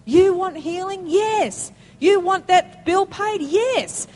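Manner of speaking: ramping up a church crowd; rousing yes. Real, not acted. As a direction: build the crowd to a shouted yes. Preacher's rising yes-calls.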